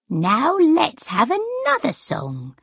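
high, slow, put-on performer's voice; grand announcement. A narrator's reading. Sarah starting the crowd on another song, grandly. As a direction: announce it with swooping pitch.

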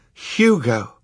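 loud called name; cry out. A narrator's reading. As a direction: call out the name. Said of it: "cried Violet".

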